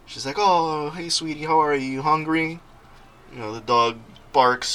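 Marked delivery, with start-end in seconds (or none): 0.4-2.6 higher-pitched cooing to a pet; doting pet greeting. Retold or imitated. Girl comes home to her winged dog, doting greeting. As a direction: voice an owner fondly greeting her puppy.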